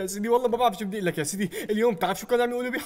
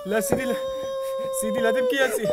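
much higher, pleading to soothe; faster than usual. Acted. Aide begging the crying leader, high pleading. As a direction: plead like calming a child.

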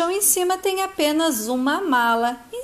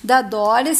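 plain reading voice

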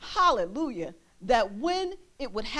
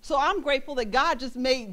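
ordinary talk

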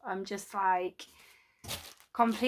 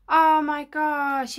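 awed; 4 semitones higher, 9 dB louder, much slower than usual. Real, not acted. Unwraps Hagrid's hut.

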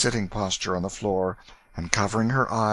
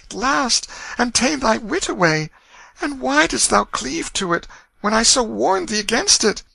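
very high-pitched woman's lament; tearful pleading mother; 4 dB louder than usual. A narrator's reading. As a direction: plead in sorrow with a beloved son. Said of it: "gazed yearningly into his face through her rising tears".

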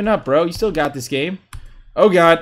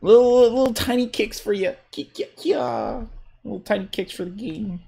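put-on teeny taunting voice; 7 semitones higher than usual. Player winning with little tiny kicks, teeny mocking voice. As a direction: make it small and high, dropping to near whisper.

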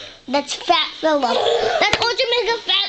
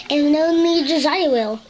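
ordinary talk

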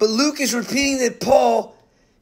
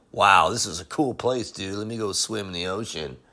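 lazy surfer dude; 12 semitones lower, 7 dB quieter than usual. Retold or imitated. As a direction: play a lazy beach dude, low and soft. Preacher mocks lazy missionaries, surfer dude.

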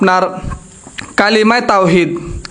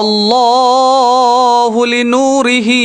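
slow recitation; much slower than usual. Shown as a demonstration.

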